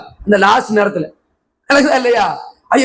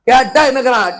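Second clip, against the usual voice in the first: ordinary talk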